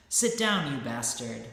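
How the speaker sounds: angry snap. Acted. Saint rebuking the heckler, acted loud angry snap. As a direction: bark it down at him, pitch jumping up. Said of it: "The saint snapped".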